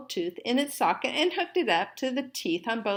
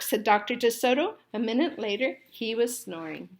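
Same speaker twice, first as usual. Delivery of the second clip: usual speaking voice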